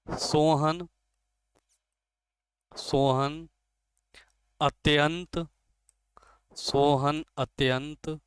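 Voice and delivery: word-by-word dictation with long pauses; dictating slowly. Shown as a demonstration. Teacher dictating a second example sentence, slow and deliberate. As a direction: read it out so students can copy it.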